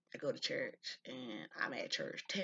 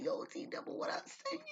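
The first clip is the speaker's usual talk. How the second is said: much higher, excited friendly holler; calling out. Retold or imitated. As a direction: yell a playful greeting to a brother. Calling out to brother by nickname.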